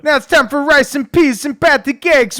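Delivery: very high, loud mock presenter; hammed-up TV host, voice way up; Lou Dobbs. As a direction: belt out a silly show announcement. Acts out Lou Dobbs show.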